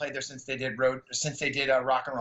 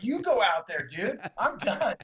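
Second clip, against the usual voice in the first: higher, cheeky refusal; mock defiance; 6 semitones higher, slower than usual. Telling the promoter he's done playing, higher and cheeky. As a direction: toss it back at him.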